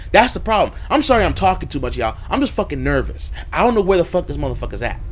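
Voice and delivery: ordinary talk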